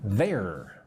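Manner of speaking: ordinary delivery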